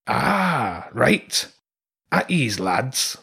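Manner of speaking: lightly voiced easygoing rat captain; relaxed, slow order to the lads; rat leader. A narrator's reading. Rat leader dismisses his troops.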